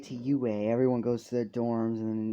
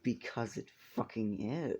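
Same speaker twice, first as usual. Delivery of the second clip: pissed off; 2 semitones lower, 7 dB quieter than usual. Acted. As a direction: say it softly, with contained irritation. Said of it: "he's fucking pissed off".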